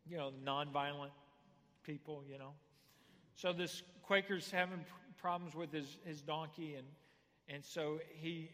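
ordinary talk, nothing marked

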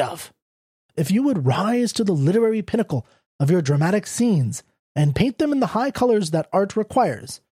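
a hint of a preachy editor; lightly voiced lecturing editor. A narrator's reading.

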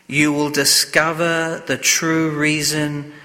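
ordinary delivery

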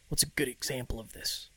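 unvoiced whisper to himself; whispered aside. Real, not acted. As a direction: whisper it under your breath. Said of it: "aside whispered to himself".

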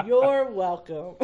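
slow, loud, smug "you're welcome"; drawn-out self-satisfied "you're welcome". Retold or imitated. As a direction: savor the punchline with mock pride. Smug punchline of story.

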